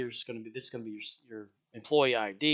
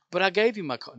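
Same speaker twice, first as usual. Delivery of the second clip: insistent customer; 7 semitones higher, 7 dB louder than usual. Retold or imitated.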